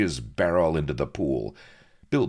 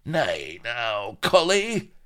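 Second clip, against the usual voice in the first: high mocking cry, leaping up louder; sneering mockery; 11 semitones higher, 6 dB louder, slower than usual. Pirate jumps up to scoff at the captain, sneering mockery. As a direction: jeer at him as you climb in pitch. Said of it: "all lean and sneering mockery".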